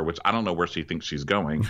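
ordinary delivery

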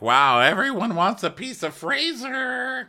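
drawn-out mocking gush; mock Eddie. Eddie teasing Fraser, high slow gushing mockery.

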